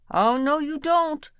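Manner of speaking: high, loud protest; the sister refusing to let the prince off; indignant. A narrator's reading. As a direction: shut him down sharply. Sister holds prince to vow.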